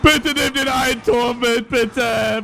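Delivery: high, slow whiny begging; whiny plea to take the tower; slow pleading. Real, not acted. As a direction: beg the opponent, drawing it out.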